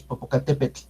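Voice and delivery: Popocatépetl with air let out at the sides of the tongue; tongue on palate for the soft tl of Popocatépetl; Popocatépetl ending in a soft sideways puff; lateral tl release. Shown as a demonstration. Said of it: "pego la lengua al paladar y saco el aire por los lados".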